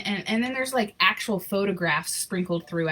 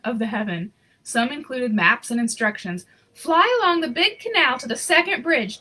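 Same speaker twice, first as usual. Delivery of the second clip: lively storybook reading voice; story narration; 4 semitones higher, 3 dB louder than usual. A narrator's reading. Reading a Christmas picture book aloud, storyteller voice. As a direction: read the story warmly to an audience.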